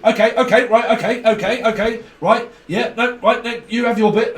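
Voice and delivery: loud, hurried mock okays; impatient loud okay-okay babble; flustered interrupter. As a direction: play someone rushing to get a word in. Mocking a flustered group member.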